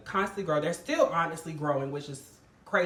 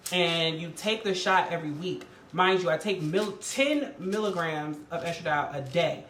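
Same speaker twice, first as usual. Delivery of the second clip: normal talking voice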